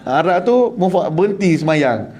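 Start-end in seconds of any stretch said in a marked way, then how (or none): none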